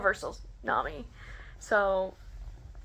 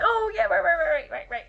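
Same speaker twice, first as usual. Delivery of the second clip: tag flustered; 8 semitones higher, 7 dB louder than usual. Retold or imitated. Her flustered apology acted out.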